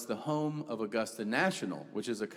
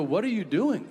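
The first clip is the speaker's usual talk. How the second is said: higher, louder alarmed question; 6 semitones higher, 4 dB louder than usual. Retold or imitated. Dad sees son grab a duck, alarmed and louder. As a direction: call out in alarm at him. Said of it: "I go".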